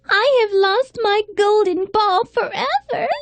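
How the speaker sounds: ordinary delivery, nothing marked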